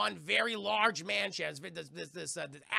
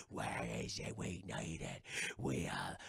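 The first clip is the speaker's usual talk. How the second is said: much lower, soft monstrous rumble; deep ghoul voice; 7 dB quieter, slower than usual. Retold or imitated. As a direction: growl from deep in the chest like a ghoul. Cursed pirates craving gold.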